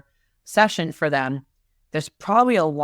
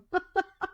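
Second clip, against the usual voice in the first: high laugh; 14 semitones higher, 7 dB quieter than usual. Real, not acted. Laughing at her own joke.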